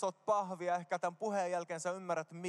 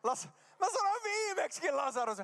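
high-pitched, flustered self-correction; flustered outburst; 11 semitones higher than usual. Real, not acted. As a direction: blurt it out in a high squeal at your own slip. Huomaa toistuvan nimivirheensä. (korkea, hätääntynyt kiljahdus)

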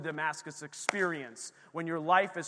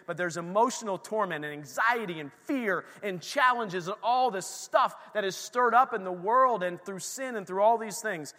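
ordinary delivery

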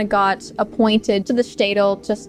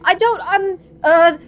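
lightly voiced flustered priest; hesitant, higher nervous answers; 7 semitones higher than usual. Priest answering under threat.

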